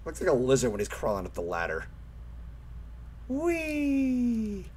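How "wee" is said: high playful wee; excited squeal. Real, not acted. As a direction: squeal with delight on the ride. Riding a mine cart.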